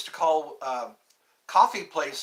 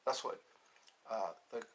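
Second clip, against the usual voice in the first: normal talking voice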